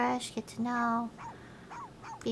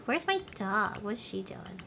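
ordinary talk

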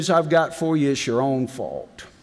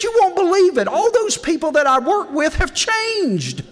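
delighted woman; 13 semitones higher than usual. Retold or imitated. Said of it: "She said".

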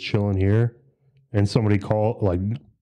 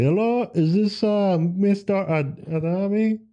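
high mock caller voice; 10 semitones higher than usual. Caller asking for the homeowner in a high mock voice. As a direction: play the scam caller all high and overly polite.

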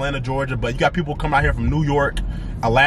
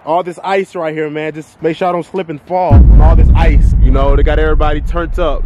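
usual speaking voice

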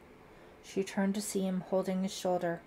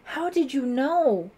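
high, loud, drawn-out astonished question; surprised cry; 7 semitones higher, 10 dB louder, slower than usual. A narrator's reading. Daughter questions her rescuing father, astonished. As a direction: ask in amazement, pitched right up. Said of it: "unable to hide her surprise".

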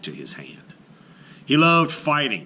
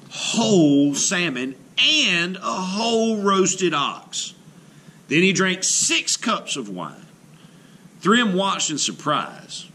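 plain reading voice

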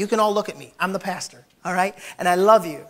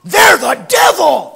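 rising to a shout, higher and louder, slow; hammed-up church crowd outrage; mock outraged gossip; 6 semitones higher, 13 dB louder, much slower than usual. Crowd gasps and condemns the offender, mock shouting. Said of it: "everybody's going".

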